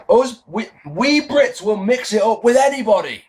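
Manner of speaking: high, strained mock Cockney shout; terrible Cockney accent. Wrestling fan imitating Jones answering Cole, bad Cockney shouting. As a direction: imitate a shouting Cockney hard man badly. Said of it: "Jones shouts ... that's a terrible Cockney accent".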